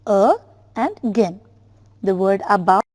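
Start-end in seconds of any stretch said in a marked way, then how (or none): 0.1-1.4 "a", pause, "and gen"; syllable by syllable. Shown as a demonstration. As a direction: separate "a" and "gen" as two sounds. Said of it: "is divided into two syllables".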